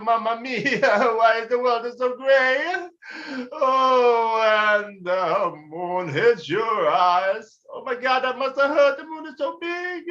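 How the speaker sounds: put-on high, slow wailing Italian accent; high mock-sobbing Italian lament; crying Italian. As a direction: moan it like a weepy Italian. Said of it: "he's a crying lad".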